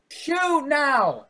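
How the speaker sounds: high, loud shouted command; in-character yell. Acted. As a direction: yell the order to an ally. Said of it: "I yell".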